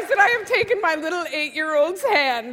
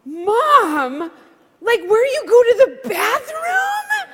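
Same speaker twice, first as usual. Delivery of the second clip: high, loud, slow shocked outburst with a laugh; loud disbelieving child's cry; 8 semitones higher, 8 dB louder, much slower than usual. Retold or imitated. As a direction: blurt it out like a stunned kid. Daughter's shocked question.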